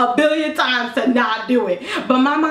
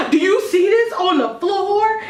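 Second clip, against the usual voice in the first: high, outraged mom voice; shrill scolding mom; 8 semitones higher than usual. Retold or imitated. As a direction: squeal like a fussy mom. Mom scolding about floor.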